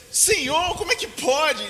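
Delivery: high, loud indignant protest; indignant Peter. Retold or imitated. Peter protests to Jesus, high and indignant. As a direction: Peter bristles at being demoted. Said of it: "E ficou com raiva".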